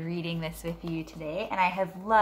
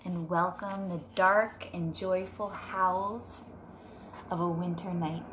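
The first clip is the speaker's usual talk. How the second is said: put-on reading to children; 6 dB quieter than usual. Teacher reading Moon Wishes to her class, gentle storybook voice. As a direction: read it softly to a class of kids. Reads picture book aloud.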